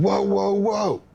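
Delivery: retold alarmed "hold on"; urgent halt. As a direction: reenact stopping someone in a high voice. Retold urgent halt before shooting.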